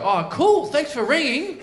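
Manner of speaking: high-pitched fake enthusiasm; sarcastically cheery, pitched well up; mock delight. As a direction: pretend to be thrilled by the call. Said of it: "what do you want me to go?".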